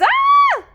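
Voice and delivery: very high, loud scream; terrified scream. Acted. Dogs fleeing the graveyard monster, shrieking scream. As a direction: scream for your life. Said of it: "The dogs screamed for their lives".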